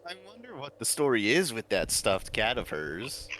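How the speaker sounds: normal talking voice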